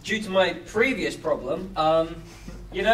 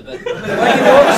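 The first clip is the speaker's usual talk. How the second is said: laughing while asking; high, loud laughing question; 13 semitones higher, 10 dB louder than usual. Real, not acted.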